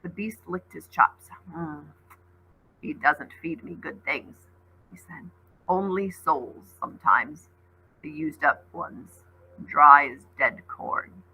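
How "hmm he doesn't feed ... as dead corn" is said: lower, slow grumbling complaint; slow low creature. Acted. Wolf creature complaining about its master's food, slow and low.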